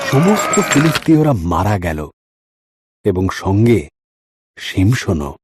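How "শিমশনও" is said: ordinary delivery, nothing marked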